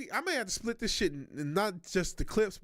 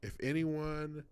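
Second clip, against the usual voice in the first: through a face mask; 6 semitones lower than usual. Real, not acted. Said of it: "trying to read through the mask".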